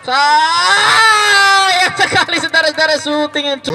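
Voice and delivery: slow, drawn-out cry of dismay; anguished shout. Acted. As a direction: wail at the missed chance. Near miss in live commentary.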